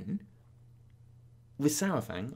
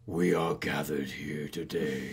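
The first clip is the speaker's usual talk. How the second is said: deep orc voice; 13 semitones lower, much slower than usual. Retold or imitated. Orc officiant impression.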